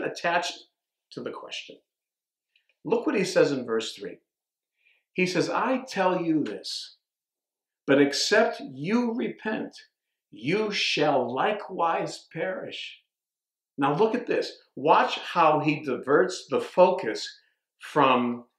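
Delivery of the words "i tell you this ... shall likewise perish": slow, solemn quote of Jesus; measured preaching of the words of Jesus; scripture read. Acted. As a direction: deliver the warning slowly and gravely.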